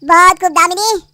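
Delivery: loud mocking बहुत खूब; sarcastic loud praise. Mother catches the scheming mistress, loud sarcastic praise. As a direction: applaud her scheme with scorn.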